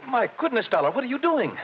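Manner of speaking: high, startled exclamation; shocked. Acted. Manager shocked to see Dollar sitting on the man, high startled cry. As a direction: gasp out your alarm.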